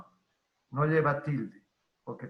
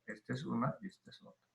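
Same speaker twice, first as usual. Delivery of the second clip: "Esta es una y esta es otra" repeated much softer and fast; quiet rapid echo of the point; soft quick repeat; 13 dB quieter, much faster than usual. Shown as a demonstration. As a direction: murmur it again quickly as an afterthought.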